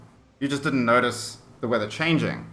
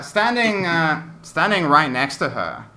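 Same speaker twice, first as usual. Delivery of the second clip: normal talking voice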